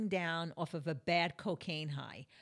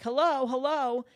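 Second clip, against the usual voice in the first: louder, higher calls for attention; calling out loudly; 5 semitones higher, 10 dB louder than usual. Retold or imitated. Still calling to the man who never looks, loud calls. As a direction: keep hailing him, insistent. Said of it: "I'm still like".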